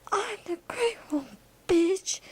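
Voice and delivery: high-pitched brash entrance voice; high, swaggering character voice; Great One. Acted. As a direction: announce yourself high and cocky. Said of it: "the Great One popped out of nowhere".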